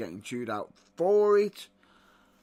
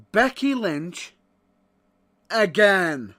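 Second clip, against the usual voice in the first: loud, exasperated rant rising to a shout; 5 semitones higher, 11 dB louder, much slower than usual. Real, not acted. Fed-up fan ranting to listeners about Charlotte versus Becky, shouting. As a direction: yell it out in disbelief at the booking.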